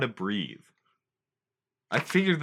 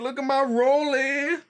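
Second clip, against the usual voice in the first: bragging show-off; 12 semitones higher than usual. Pretend. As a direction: show off the watch proudly. Bragging rich firefighter bit.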